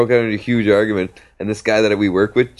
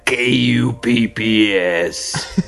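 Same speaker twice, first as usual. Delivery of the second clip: very slow put-on spelling; Manny spelling it; 3 semitones higher, much slower than usual. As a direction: spell each letter slowly in his voice. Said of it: "and he talked like this".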